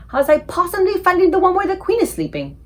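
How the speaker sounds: a touch of a giant gaping at the palace; amazed giant. Acted. The giant staring at the huge palace, awed. Giant worried about finding Queen.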